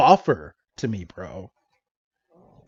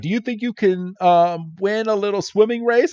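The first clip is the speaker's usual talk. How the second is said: put-on a light, higher girl's voice; lightly voiced, higher-pitched challenge; Sissy's voice; 7 semitones higher than usual. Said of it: "and she's like".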